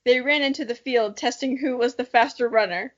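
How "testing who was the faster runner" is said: usual speaking voice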